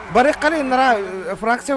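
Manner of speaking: excited. Real, not acted. Urgent excited talk.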